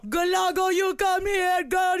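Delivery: put-on Caribbean accent. Friend performs a joke accent for the others, hammed-up island accent. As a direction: call her over in a mock Caribbean lilt. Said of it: "your accents because they started off".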